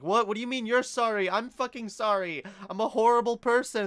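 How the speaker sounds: high, loud, fast protest; indignant outburst. Retold or imitated. Speaker refusing a friend's apology, indignant self-blaming outburst.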